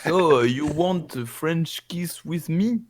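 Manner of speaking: put-on slow French accent, rising at the end; hesitant proposition in thick French-accented English. Igor offering Jotaro a kiss, French accent. Player's Franglais flirting.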